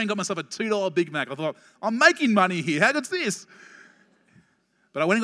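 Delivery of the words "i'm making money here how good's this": high, loud burst of glee; thrilled, voice leaping up; delighted gloating. Retold or imitated. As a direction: crow over a lucky bargain. Acts out delight at a bargain.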